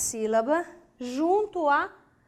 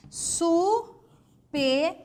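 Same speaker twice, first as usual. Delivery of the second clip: "Su-pe" as two held, higher syllables; 4 semitones higher than usual. Shown as a demonstration. Teacher trying a second possibility, separate higher syllables. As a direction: leave "Su-pe" open, without the r. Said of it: "Vamos uma segunda possibilidade?".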